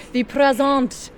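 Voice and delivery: put-on "The present" loud in an Australian accent. Presenting the gift in a loud Australian accent. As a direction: belt "The present" like an Aussie. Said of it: "I'm doing an Australian accent".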